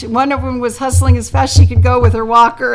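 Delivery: normal talking voice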